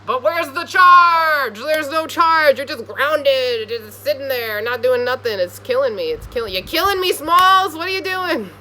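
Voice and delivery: loud, high, slow exasperated rant; hammed-up despair at someone stuck; frustrated yelling. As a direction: yell at a motionless friend. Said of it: "It's like".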